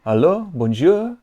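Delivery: put-on high, loud French-accented phone greeting; badly faked French lilt, rising at the end; attempted French accent. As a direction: answer brightly in a shaky attempt at French. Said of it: "I cannot do a French accent".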